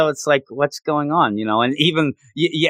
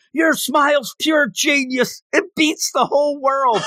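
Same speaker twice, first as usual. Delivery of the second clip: raving coach; 12 semitones higher than usual. Retold or imitated. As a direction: swoon over the smile, stretched out high. Coach raving about smile.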